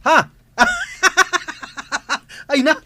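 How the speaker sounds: high, loud laughter breaking into the words; can't stop laughing; loud cackling amusement; laughing hard. Real, not acted. Finds the video hilarious.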